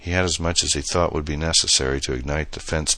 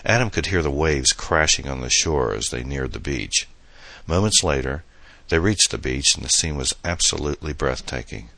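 ordinary delivery

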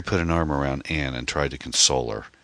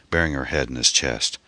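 ordinary delivery

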